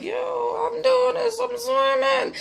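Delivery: put-on very high, slow, astonished child voice; amazed little girl. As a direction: squeal it like a kid surprised at herself. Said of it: "she started to shock herself".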